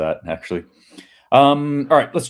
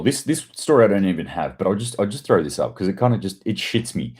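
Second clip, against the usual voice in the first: ordinary talk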